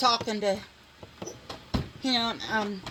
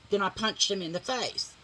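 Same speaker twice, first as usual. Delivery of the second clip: ordinary talk, nothing marked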